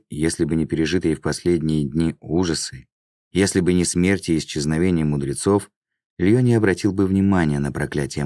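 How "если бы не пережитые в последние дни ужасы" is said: ordinary delivery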